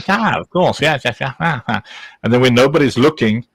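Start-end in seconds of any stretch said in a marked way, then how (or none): 0.1-1.8 hammed-up British impression; Mister Foster. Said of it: "super British with his".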